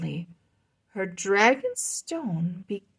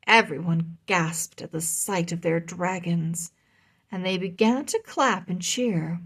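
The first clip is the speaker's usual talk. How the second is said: ordinary delivery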